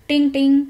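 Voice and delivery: a bright, loud ting-ting like a bus bell; bell ding imitation. Narrator imitates the conductor's bell as the bus arrives, loud ding.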